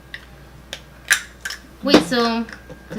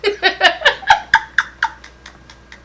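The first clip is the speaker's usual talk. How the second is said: loud laugh; 11 semitones higher, 10 dB louder than usual. Real, not acted. Laughing at friend.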